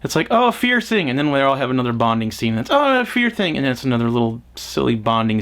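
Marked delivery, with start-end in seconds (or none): 0.3-1.1 high mocking singsong; mocking quote. Reviewer mocking the film's fear scenes, high singsong. 2.7-3.6 high mocking singsong; mocking quote. Reviewer mocking the film's fear scenes, high singsong.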